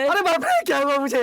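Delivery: high-pitched cry of alarm; acted yelp of shock; startled yelling. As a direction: wake in a panic and cry out. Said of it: "अबे चिल्ला मत".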